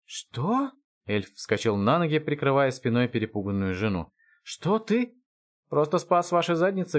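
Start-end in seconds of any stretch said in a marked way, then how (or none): 0.1-0.7 high-pitched stunned question; startled. Acted. Эльф вскакивает, ошарашен появлением. 4.4-5.2 high-pitched stunned elf; startled disbelief. Acted. Эльф потрясён встречей.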